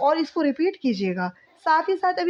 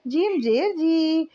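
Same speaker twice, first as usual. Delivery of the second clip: slow spelling drill; much slower than usual. Shown as a demonstration. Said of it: "ہم کو اسے اس طریقے سے پڑھنا ہے".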